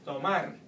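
"tomar" with a loud, punched MAR; stress on last syllable. Shown as a demonstration. Teacher giving a verb example, final-syllable stress. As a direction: hit the end of "tomar". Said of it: "stress the ending of the word".

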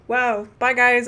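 ordinary talk

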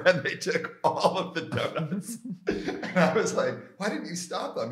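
laughing while telling. Real, not acted. Telling a guest the stolen donut story, laughing. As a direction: let the laughter spill through each line.

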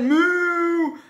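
long, loud, high moo; cow moo. A narrator's reading. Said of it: "the cow said".

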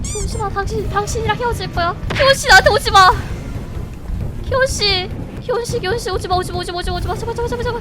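high frantic begging; panicked pleading. Real, not acted. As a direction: beg the chaser to stop. Pleading with the chasing monster.

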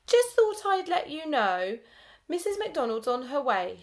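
a grinning tell-tale's sneer; smug Rosie. A narrator's reading. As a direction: gloat like a smug schoolgirl. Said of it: "a big smarmy grin across her face".

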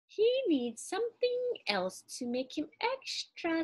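high-pitched character musing; musing aloud. A narrator's reading. Old woman ponders what the musubi man still needs, musing high.

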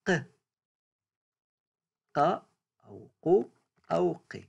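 teacher isolating ق, the uvular q; qaf sound demo. Shown as a demonstration. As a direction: sound out ق on its own, crisp and throaty. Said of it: "هو صوت".

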